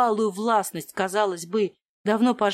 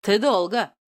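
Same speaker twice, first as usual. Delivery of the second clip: usual speaking voice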